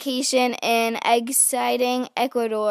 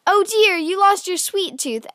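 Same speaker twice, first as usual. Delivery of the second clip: put-on a mother fussing over her child; concerned mother; 7 semitones higher than usual. A narrator's reading. Mommy consoles her daughter, high and fussing. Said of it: "asked Mommy".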